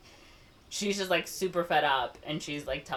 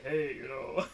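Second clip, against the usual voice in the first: lightly put-on voice for a pickup line; 3 semitones lower, 3 dB quieter, slower than usual. Teenage cousin asks her out for Usnavi, in a put-on voice. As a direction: put on a voice to sound older. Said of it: "puts on, like, a voice".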